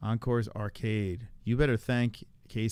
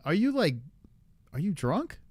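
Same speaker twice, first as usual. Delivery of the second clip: ordinary talk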